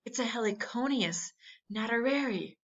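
name it in quiet amazement; awed. A narrator's reading. Uncle naming the rare butterfly, hushed and awed.

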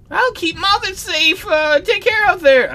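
high, slow younger brother voice. Acted. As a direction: voice the kid brother, lightly. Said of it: "I forgot your voice".

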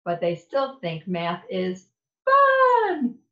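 plain reading voice

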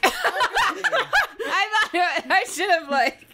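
laughing. Real, not acted. Laughs at own mistake.

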